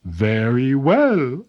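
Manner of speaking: slow, solemn spirit granting a wish; spirit of the mountain. A narrator's reading. Spirit grants Tasaku's final wish, slow and solemn. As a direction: let the spirit give way, drawn out. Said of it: "said the spirit".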